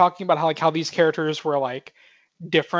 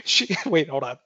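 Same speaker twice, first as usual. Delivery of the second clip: breaking up laughing mid-sentence; can't stop laughing; 2 semitones higher, 3 dB quieter than usual. Real, not acted. Co-host trying to say a meme line, breaking up laughing. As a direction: try to get the line out through real laughter. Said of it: "I can't even say without laughing".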